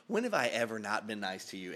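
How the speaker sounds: ordinary talk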